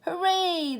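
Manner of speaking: high loud cheer. A narrator's reading. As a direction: cheer the jump. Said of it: "they shouted".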